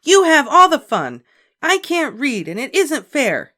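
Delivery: high, loud wail of unfairness; howling complaint. A narrator's reading. Ramona complaining to her mother, loud and wailing. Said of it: "ended in a howl".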